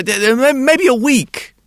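put-on high, loud, hesitant child; little boy voice. A narrator's reading. Boy's voice in story.

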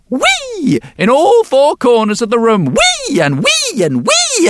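high-pitched whooshing whee as each fish flies; gleeful whee. A narrator's reading. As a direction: fling out a delighted whee with every throw. Old man hurling fish gleefully.

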